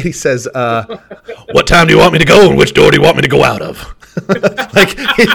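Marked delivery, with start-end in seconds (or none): 1.5-3.9 loud coach impression; raised, punchy retelling of the coach's comeback; Ed Orgeron. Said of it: "how did he say it?".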